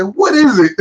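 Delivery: high-pitched, exasperated mock retort; retold irritated yell. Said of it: "Can you yell?".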